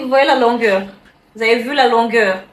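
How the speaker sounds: excited. Acted. Showing off the wig length.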